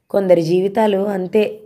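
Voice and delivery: ordinary delivery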